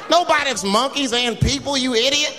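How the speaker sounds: high, exasperated scolding; mock outrage. Berates scientists.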